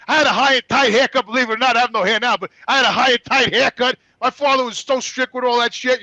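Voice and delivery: ordinary talk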